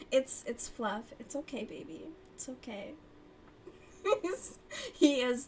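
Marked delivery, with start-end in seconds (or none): 1.2-2.9 soothing cat. Real, not acted. To the startled cat, softly reassuring it. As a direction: hush the cat gently.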